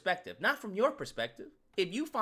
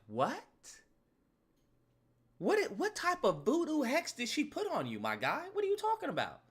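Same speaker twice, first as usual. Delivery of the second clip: high-pitched, baffled questioning; incredulous friend; 6 semitones higher, 3 dB quieter than usual. Acted. Friend asking what hex she put on him, incredulous. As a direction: challenge your buddy in rising disbelief. Friend reacts to the smitten man.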